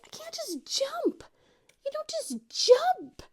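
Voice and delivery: very high panicked squeak; squeaky. Retold or imitated. Nervous zip-liner protesting to husband, super squeaky. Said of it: "my voice is getting all super, super squeaky".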